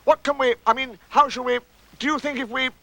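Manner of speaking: high, faltering worry; flustered, high-pitched concern; in distress. Acted. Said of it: "said Pooh in distress".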